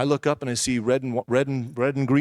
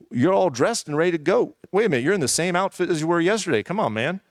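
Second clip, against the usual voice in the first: higher, singsong mock surprise; lightly acted, higher-pitched ribbing; lightly voiced flatmate; 6 semitones higher than usual. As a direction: imitate a puzzled flatmate, pitch swooping up and down. Retold wife teasing him on waking.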